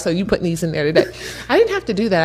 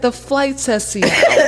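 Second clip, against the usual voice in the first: sarcastic laugh; 5 semitones higher, slower than usual. Retold or imitated. Sarcastic reply to Josh.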